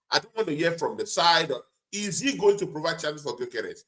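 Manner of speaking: normal talking voice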